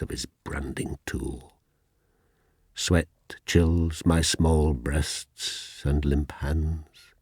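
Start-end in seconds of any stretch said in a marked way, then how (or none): 0.0-1.5 whisper. Acted. Line fades into whisper.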